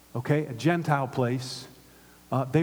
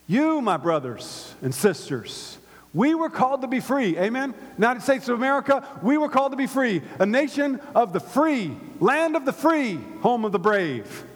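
high, loud, soaring sermon climax; rising pulpit fervor; preaching at full force; 8 semitones higher, 3 dB louder than usual. Acted. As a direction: lift the congregation with it.

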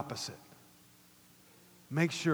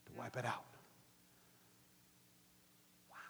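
very soft, low sermon delivery; quiet, intimate preacher's voice; hushed preaching; 4 semitones lower, 9 dB quieter than usual. Real, not acted. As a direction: drop to a near-murmur, pausing between phrases.

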